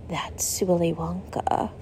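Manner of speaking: "That's Willy Wonka." breathed as a slow, soft, whimsical whisper; Gene Wilder whisper. Retold or imitated. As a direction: hush it like a magical, whimsical Wonka. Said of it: "he said it in his Gene Wilder ... magical, whimsical way".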